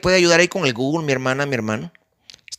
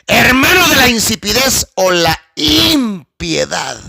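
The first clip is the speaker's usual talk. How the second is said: preached shout; 5 semitones higher than usual. Real, not acted. Repeated climax.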